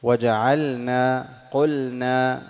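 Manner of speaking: قلنا and وجعلنا said very slowly, the lam held clear before the nun; drawn-out recitation. Shown as a demonstration. Teacher demonstrating the clear lam before nun, very slow. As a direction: stretch each word out and keep the lam distinct. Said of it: "فلذلك لزم الإظهار".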